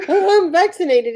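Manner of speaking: high-pitched mock announcement; imitated blurt. Friend teases about an awkward announcement, high blurt. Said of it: "scream in his face that I'm vaccinated".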